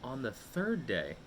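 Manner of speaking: plain reading voice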